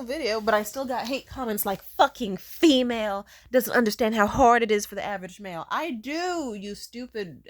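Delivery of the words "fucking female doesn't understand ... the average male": mock hater voice. Mocking a hater's comment.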